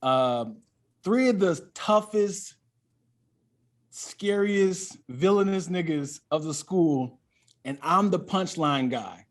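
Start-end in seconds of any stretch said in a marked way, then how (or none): none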